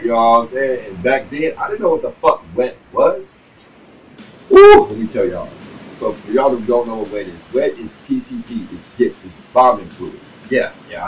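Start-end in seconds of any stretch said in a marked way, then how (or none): 4.5-4.9 a loud, high whoop; hype woo. Real, not acted. Storyteller hyping up the crowd with a loud high whoop. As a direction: let out a big excited woo.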